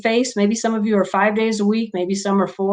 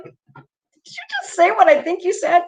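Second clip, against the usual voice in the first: incredulous; 10 semitones higher than usual. Retold or imitated. Imitating teachers' disbelief.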